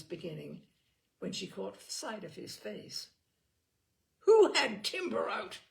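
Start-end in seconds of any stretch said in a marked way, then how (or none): 4.2-5.6 sudden loud angry demand; furious. A narrator's reading. Simon confronting Eleanor and Brat, furious. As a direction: bark the question in fury. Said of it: "he said, furious".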